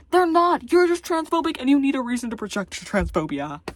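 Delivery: loud, high indignant outburst; yelling. Real, not acted. Arguing back at transphobes, yelling. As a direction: yell the rebuttal, starting high. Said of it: "I just had to yell at myself".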